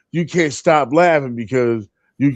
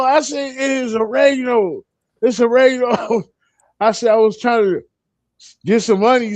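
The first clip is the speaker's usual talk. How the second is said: laughing; 8 semitones higher than usual. Real, not acted. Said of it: "And I couldn't stop laughing".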